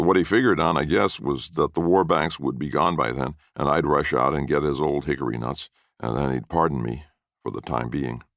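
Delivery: usual speaking voice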